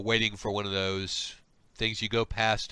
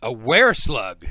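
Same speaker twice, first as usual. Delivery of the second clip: astonished; 10 dB louder than usual. Acted. Slug reacts to were-slug claim.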